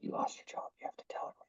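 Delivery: hushed whisper into an ear; a teacher whispering a secret direction to an actor. Retold or imitated. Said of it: "he'd tell me that in my ear".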